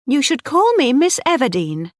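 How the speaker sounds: icy correction; coldly. A narrator's reading. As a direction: put him firmly in his place. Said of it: "she said coldly".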